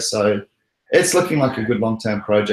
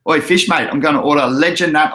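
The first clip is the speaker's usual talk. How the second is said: put-on Aussie, pitched up and loud; broad Australian, calling out to a mate; hammed-up Australian accent; 4 semitones higher, 5 dB louder than usual. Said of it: "do it in the Aussie accent".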